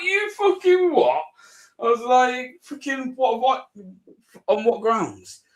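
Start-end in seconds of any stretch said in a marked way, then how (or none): 0.0-1.2 high, slow, disbelieving yelp; incredulous outrage. Retold or imitated. Outraged reply to the cop. 2.6-5.4 indignant protest. Retold or imitated. Arguing with the arresting cop.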